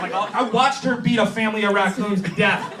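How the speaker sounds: usual speaking voice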